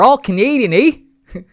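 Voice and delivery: rally the Canadians with a grin; playful Canadian cheer. Real, not acted. Streamer cheers his Canadian viewers, high and loud.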